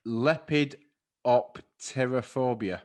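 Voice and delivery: lepidopterophobia said slowly, syllable by syllable; slow careful pronunciation. Real, not acted. Reading out hard word slowly.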